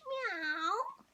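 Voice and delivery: high meowing cat. Acted. Ginger cat answering Missus Jolly, high mew. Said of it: "I needed my cat voice there".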